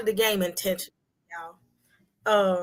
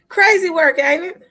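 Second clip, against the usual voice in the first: high, loud exclamation to the chat; crazy work; 9 semitones higher, 8 dB louder than usual. Real, not acted. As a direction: toss it out, amazed at the drama. Exclaiming to chat about the drama.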